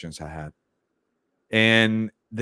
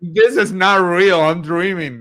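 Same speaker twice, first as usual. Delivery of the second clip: mock disbelief; 8 semitones higher, 7 dB louder than usual. Coach jokes about things going well, mock disbelief. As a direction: act out loud, stretched-out disbelief.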